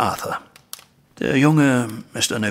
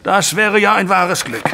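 sharp, higher, louder sarcasm; bitter retort; 7 semitones higher, 4 dB louder than usual. Acted. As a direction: cut him with scorn. Father's bitter retort to son. (bissig, laut und höher)

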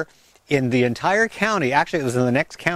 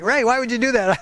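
imitated viewer objection, pitched up; higher-voiced mock protest; mock disbelief; 9 semitones higher than usual. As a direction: question it like a creeped-out viewer. Voices viewers' shocked reaction.

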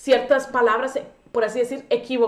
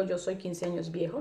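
quiet rejected phrase; 6 semitones lower, 10 dB quieter than usual. Shown as a demonstration. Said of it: "No, yo no digo".

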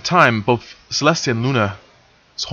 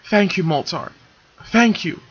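grateful; 8 semitones higher than usual. Acted. Silver thanking tearfully.

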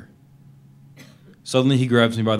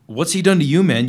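shouting out in horror at the beating; horrified shout; 7 semitones higher, 10 dB louder than usual. Acted. Captive shouts at the lieutenant over his beaten friend, horrified. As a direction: yell it, shocked and outraged.

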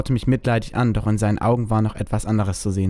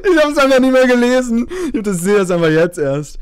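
higher, louder talk still laughing; laughing through words; 13 semitones higher, 6 dB louder than usual. Real, not acted. Still laughing after the outburst, higher and louder. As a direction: talk while still cracking up.

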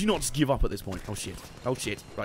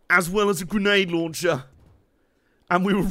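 ordinary talk, nothing marked

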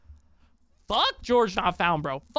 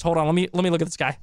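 ordinary talk

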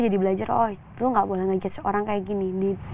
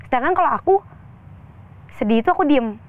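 ordinary talk

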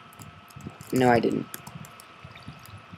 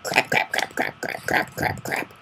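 cartoon duck; 6 dB quieter than usual. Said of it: "it was a duck who went".

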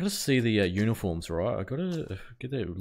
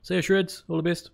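sing-song farewell; 10 semitones higher, 4 dB louder than usual. Real, not acted. Farewell repeated to chatter.

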